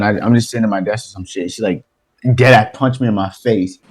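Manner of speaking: ordinary talk, nothing marked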